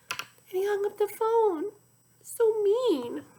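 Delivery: shocked reader comment; dismayed aside. Real, not acted. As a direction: tut at how mean it was. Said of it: "teacher reacts to villain".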